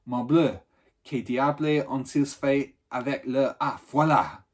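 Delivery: put-on loud French exclamations in a French accent; a Frenchman fumbling for the bolt, in French; French muttering. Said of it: "muttering too, and in a foreign language".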